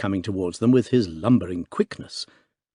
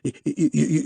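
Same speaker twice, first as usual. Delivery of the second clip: repeated y- catches; stuttering in shock; stammer; 5 semitones higher, faster than usual. A narrator's reading. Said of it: "he stammered".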